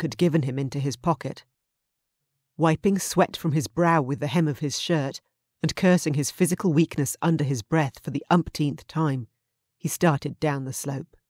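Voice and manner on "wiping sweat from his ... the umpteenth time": ordinary delivery, nothing marked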